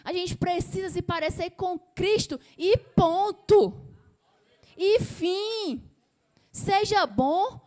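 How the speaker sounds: preaching at full force. Real, not acted. Preacher insisting to his congregation that the church must look like Christ, loud and slow. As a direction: drive the point home loud and slow, pounding every word.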